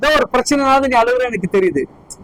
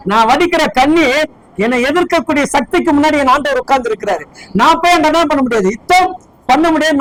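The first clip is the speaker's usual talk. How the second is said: raised, driving sermon voice; full-force preaching; 5 semitones higher, 3 dB louder than usual. Acted. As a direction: preach it out to the whole hall.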